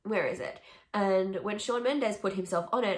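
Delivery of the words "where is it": ordinary talk, nothing marked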